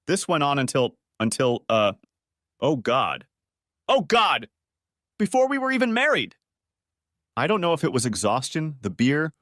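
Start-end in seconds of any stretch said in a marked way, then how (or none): 2.6-6.4 shouting out in shock, much higher; anguished cry. A narrator's reading. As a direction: cry out, voice cracking up high. Realizing the betrayal, crying out.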